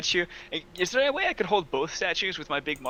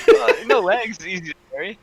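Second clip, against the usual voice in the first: laughing; 3 semitones higher, 9 dB louder than usual. Real, not acted. Joking about legless statue.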